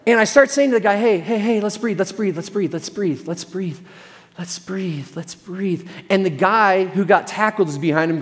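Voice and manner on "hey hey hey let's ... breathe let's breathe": soothing, fading. Retold or imitated. Calming an enraged skier.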